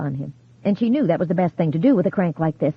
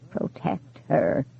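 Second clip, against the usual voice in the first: soft, breathy, trembling warning; whispery vibrating voice; 3 semitones lower, 5 dB quieter, much slower than usual. A narrator's reading. Ghost warns his widow over the phone, whispery and trembling. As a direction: let the voice tremble and fade.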